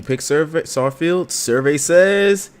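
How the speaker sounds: loud, high, drawn-out announcer call; hammy quiz-host shout; game-show host. Pretend. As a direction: build suspense for the answer reveal. Imitating a game-show host reveal.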